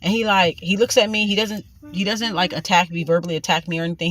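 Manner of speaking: ordinary delivery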